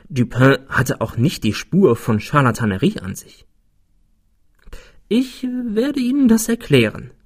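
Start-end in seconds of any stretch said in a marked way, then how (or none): 5.1-7.1 high-pitched, matter-of-fact character voice; simply stated. A narrator's reading. Friend offers to explain to narrator, simply stated. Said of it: "sagte er einfach".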